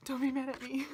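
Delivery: high, loud little plea; plaintive and overwrought; pleading. Real, not acted. Pleading with her deck.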